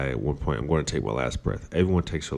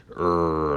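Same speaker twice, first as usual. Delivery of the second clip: frustrated growl. Retold or imitated. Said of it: "I'm like".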